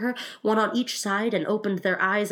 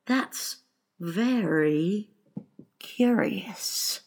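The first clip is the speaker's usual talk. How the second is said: long-breathed wonder; 3 semitones higher, much slower than usual. A narrator's reading. Mock Turtle marvels at Alice's tale, long-breathed. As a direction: pause between words, airy and slow. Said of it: "drew a long breath and said".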